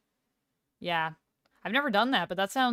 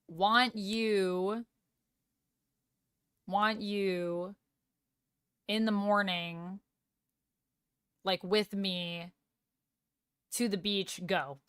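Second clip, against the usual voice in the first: slow, halting phrases with long gaps; piecing words together; much slower than usual. Shown as a demonstration. Streamer showing how German structures a sentence, piecing words together. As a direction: lay out each chunk separately, working out the order. Demonstrates German word order in English.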